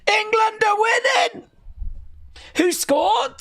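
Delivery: screaming high with joy; goal celebration. Real, not acted. Streamer celebrates England's goal, screaming high. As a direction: erupt like your team just scored.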